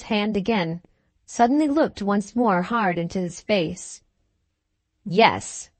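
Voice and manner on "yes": bitter laugh. A narrator's reading. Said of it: "with a repetition of the bitter laugh".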